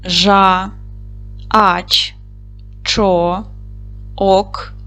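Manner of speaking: each syllable said separately with pauses. Shown as a demonstration. Teacher dictating syllables to pupils, syllable by syllable. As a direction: break їжачок into slow, separate syllables. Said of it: "Склад".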